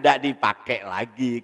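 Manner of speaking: normal talking voice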